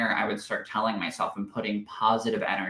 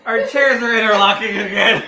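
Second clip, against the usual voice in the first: loud, high words squeezed through laughter; laughing fit; 9 semitones higher, 9 dB louder than usual. Real, not acted. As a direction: report it while still cracking up.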